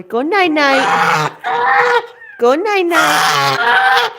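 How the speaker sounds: high, loud, slow drawn-out coo; coaxing the parrot to sleep, high and drawn out. Real, not acted. Putting bird to bed.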